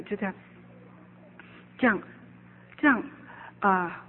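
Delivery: usual speaking voice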